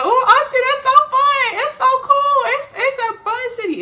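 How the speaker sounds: very high, loud mock gushing; gushing about Austin. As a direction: squeal it like an excited fan of the city. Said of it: "I know everybody".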